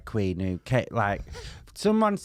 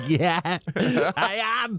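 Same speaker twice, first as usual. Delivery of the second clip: slow, laughing, higher; laughing reply; 6 semitones higher, much slower than usual. Retold or imitated. She replays her own giggly reply to boyfriend, laughing. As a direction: giggle it out slowly. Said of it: "I'm like".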